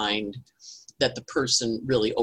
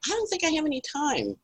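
high, fretful inner voice; fretting to herself; 13 semitones higher, 4 dB quieter than usual. Retold or imitated. Retelling cooking, she frets in a high voice. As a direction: squeak it out like you're in a panic. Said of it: "And I'm like".

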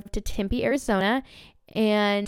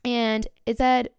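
ordinary talk

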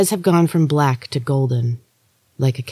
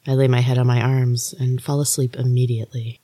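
ordinary delivery

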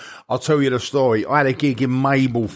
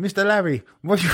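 higher mock Mexican accent; Mexican housekeeper; 8 semitones higher than usual. Housekeeper in an American sitcom, mock Mexican accent. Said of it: "like, the Mexican housekeeper".

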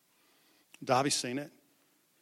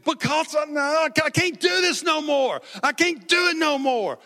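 put-on much higher, louder wailing boy's cry; imitating bawling; 13 semitones higher, 10 dB louder than usual. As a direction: reenact the sobbing boy, wailing high. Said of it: "he was bawling".

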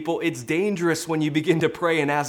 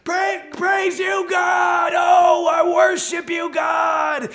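very high, loud ecstatic shout; child's ecstatic worship; 7 dB louder, much slower than usual. Retold or imitated. Son thanking God for a puppy, shouting with joy. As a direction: shout praise like an overjoyed seven-year-old. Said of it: "and he went".

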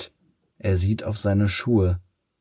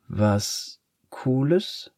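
put-on hurt boy sniffs the words back slowly; sniffling; 5 semitones higher, much slower than usual. A narrator's reading. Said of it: "schnieft der Junge".

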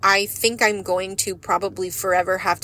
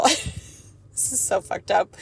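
slow, softer, laughing through it; laughing aside; 4 dB quieter, much slower than usual. Real, not acted. Vlogger laughing softly at her dark saying for viewers. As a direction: laugh at your own dark joke.

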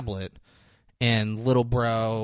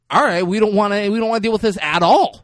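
urgent bail-out; 8 semitones higher, 7 dB louder than usual. Retold or imitated. Acted decision to pull out.

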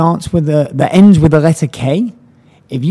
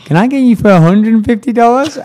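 higher, louder cheeky ask; playful fundraiser pushing her luck; cheeky pitch; 4 semitones higher, 4 dB louder than usual. Retold or imitated. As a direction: coax him with a grin. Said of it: "And she went".

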